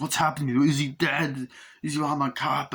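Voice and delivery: a worried cop asks about the body; lightly voiced startled cop; confused cop. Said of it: "Arnie's like".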